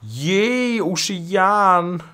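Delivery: high, drawn-out "yay"; excited cheer. Real, not acted. Gamer greeting the ocean in the game, gleeful high cheer.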